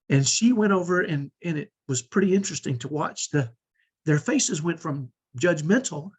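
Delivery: ordinary delivery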